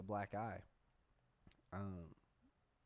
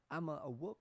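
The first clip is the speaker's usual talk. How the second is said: higher-pitched mock Italian game voice; Super Mario; 6 semitones higher than usual. Comic showing threats don't work in Mario accent, botched Mario impression. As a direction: attempt a threat in a cartoon plumber accent. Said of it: "threatening in a Super Mario".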